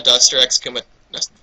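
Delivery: ordinary delivery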